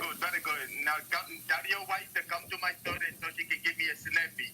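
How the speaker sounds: hammed-up Indian accent; put-on Indian accent. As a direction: pitch a dodgy deal in an Indian accent. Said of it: "Oh, he's doing an Indian".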